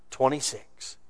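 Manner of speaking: usual speaking voice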